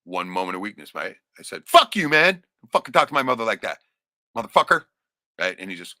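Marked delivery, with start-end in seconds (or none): 1.7-4.9 loud, high angry yell; blowing up. Retold or imitated. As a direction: explode at him at full volume. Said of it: "I just blew up".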